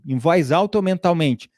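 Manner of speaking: ordinary talk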